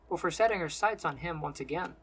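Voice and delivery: ordinary delivery, nothing marked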